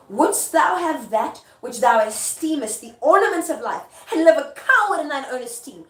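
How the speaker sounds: high, loud declamation; forceful theatrical challenge; goading stage speech. Acted. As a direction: taunt him like a stage queen. Performs Lady Macbeth goading her husband.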